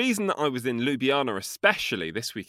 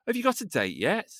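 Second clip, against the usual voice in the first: mocking question; 8 semitones higher than usual. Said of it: "they said to me".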